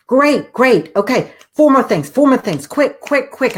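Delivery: high, quick, eager prodding; urgent coaxing. Retold or imitated. Daughter urgently coaxing her panicked mother, high and eager. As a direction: hurry her along before she thinks.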